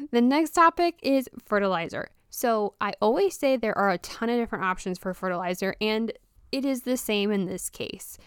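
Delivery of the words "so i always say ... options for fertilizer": normal talking voice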